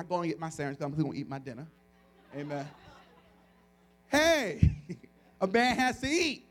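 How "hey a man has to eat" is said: loud, high comic self-defence; big, jokey indignation; mock indignant. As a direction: protest playfully, with a laugh.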